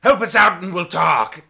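booming high-pitched bellow of a giant pirate captain; big strained battle shout; busy Goliath shouting. Acted. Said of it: "mid-smash stops and goes".

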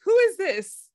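high, baffled question; mom confused. Retold or imitated. Mom getting a holiday text from Pierre, high and baffled. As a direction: ask it high in confused disbelief. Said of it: "My mom is like".

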